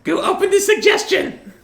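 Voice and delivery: very high, loud yelling character voice; squeal-yell it at full volume; Goo. Acted. Player's character yells back at the priest, high squealed shout. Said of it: "Goo's gonna yell back at him".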